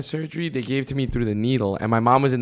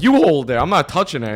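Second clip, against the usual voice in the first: louder, higher re-enacted refusal; defiant retort; 5 semitones higher, 5 dB louder than usual. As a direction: throw the job straight back in argument. Retold argument with aunt.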